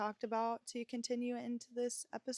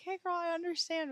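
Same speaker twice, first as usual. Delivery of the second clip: put-on higher, brighter reassuring girl-talk; friendly comfort; 9 semitones higher, 5 dB louder than usual. The supportive friend she wished she'd had, high and reassuring. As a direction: reassure a struggling teen friend. What she'd say to younger self.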